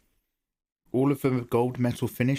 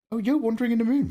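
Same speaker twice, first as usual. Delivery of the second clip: excited greeting; 13 semitones higher, 4 dB louder, faster than usual. Real, not acted. Greeting a new viewer in chat.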